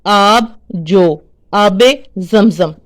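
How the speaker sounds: examples drawn out slowly, each word spaced; slow demo. Shown as a demonstration. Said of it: "जो अल्फाज अपने वजन पर हों".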